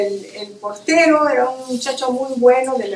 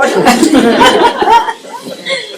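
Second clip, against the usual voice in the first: real high burst of laughter; bright amused laugh; 10 semitones higher, 7 dB louder than usual. Real, not acted. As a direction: laugh out loud at the memory. Laughing at her own story.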